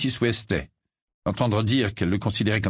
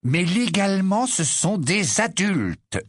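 slow, weary insistence; tired voice; 9 semitones higher, slower than usual. A narrator's reading. Husband insists on the law, slow and weary. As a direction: drag it out, exhausted. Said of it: "de la même voix fatiguée".

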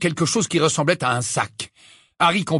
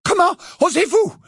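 high, indignant cry; outraged cry; 14 semitones higher, 4 dB louder than usual. A narrator's reading. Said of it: "s'écria-t-elle".

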